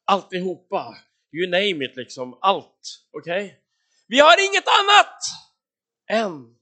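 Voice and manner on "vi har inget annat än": very high, loud protest; protesting cry. Acted. Disciples telling Jesus what they lack, loud protest. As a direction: cry out in exasperation, pitched right up.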